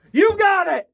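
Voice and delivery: storyteller springs the scare ending; jump scare shout. Acted. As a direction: leap out at the listener.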